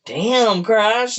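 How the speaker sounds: mock-impressed exclamation; impressed coworker. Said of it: "Eugene was like".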